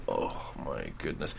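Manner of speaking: embarrassed aside to yourself; mortified mutter. Retold or imitated. After a blunder, a low soft mortified murmur. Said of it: "just going".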